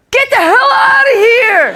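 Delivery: high, loud furious yell; a hammed-up angry dad shouting; father yelling. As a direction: roar at the kid to get out. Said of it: "I heard my father go".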